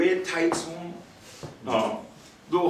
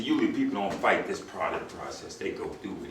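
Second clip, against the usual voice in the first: much softer, low, soothing reassurance; softly voiced officer talking a prisoner down; calming officer; 12 semitones lower, 6 dB quieter than usual. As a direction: settle the scared kid down gently. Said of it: "He calmed me down".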